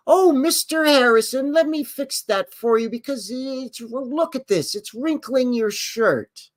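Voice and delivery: high, sugary caring voice, slowing at the end; sweet nurse. Retold or imitated. Nurse fussing over patient in wheelchair, high sugary voice. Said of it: "a nurse comes in and goes".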